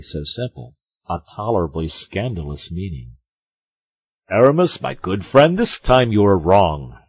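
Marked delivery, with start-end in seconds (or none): 4.3-7.0 loud, firm objection; interrupting. A narrator's reading. Porthos checks Aramis for a scandalous hint, interrupting firmly. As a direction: cut in forcefully to correct a friend. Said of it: "interrupted Porthos".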